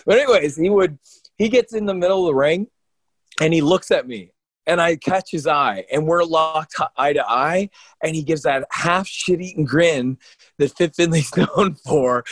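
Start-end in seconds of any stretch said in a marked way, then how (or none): none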